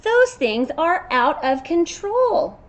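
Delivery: high, loud and slow, gaping at the hooves; a wide-eyed unicorn who can't believe it; amazed unicorn. Acted.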